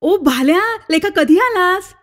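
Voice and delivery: a glad, slowed call across the yard, a bit high; joyful call. Acted. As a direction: greet an old friend with mild delight. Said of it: "आनंदानं ओरडला".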